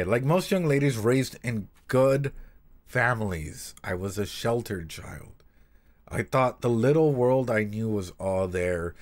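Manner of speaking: slow reading voice that sinks to a hush by the end; slow, hushed storybook reading; story reading. Acted. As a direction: read it gently and let it fade nearly to a whisper. Reading the story passage aloud.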